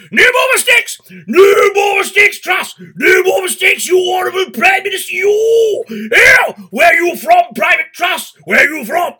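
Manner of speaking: screaming drill sergeant, very high and loud; military drill sergeant. Acted. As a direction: bellow it like a parade-ground sergeant. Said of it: "in a military voice because it sounds very military".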